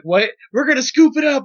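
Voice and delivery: can't believe what he's hearing; incredulous. Retold or imitated. To friends about scooping up his hat off the street, incredulous. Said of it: "I'm like".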